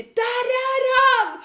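high, drawn-out pointing-him-out yell; slow, high acted shriek; retold scream. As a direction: re-enact screaming to the police that he's there. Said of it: "jag sträcker ut armen och skriker".

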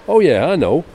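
loud high Billy; voicing Billy. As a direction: blurt it out like a keen teenage lad. Reading Billy's reply.

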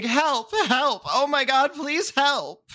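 high, frantic cries for help; panicked screaming for help. Acted. Twink bursting into the bar, screaming for help. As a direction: yell it like you're being chased. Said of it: "and is screaming".

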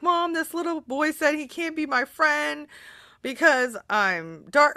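put-on a hurt little boy telling mom; lightly voiced upset kid; quoting her crying son. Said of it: "he's like".

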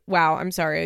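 normal talking voice

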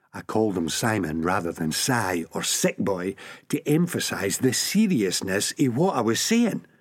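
ordinary delivery, nothing marked

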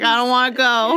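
a hint of a comedian's reluctant protest; John Mulaney. Podcaster imitates an astronomer skipping a conference, mock reluctance. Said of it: "He pulls the John Mulaney".

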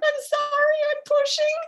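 high squeaky panicked apology; urgent apology. Retold or imitated. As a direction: squeak it out mid-push, desperate. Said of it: "I was like".

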